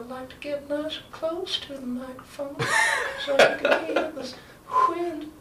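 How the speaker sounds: put-on high old man voice, whistling through teeth. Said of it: "wind whistling through my teeth".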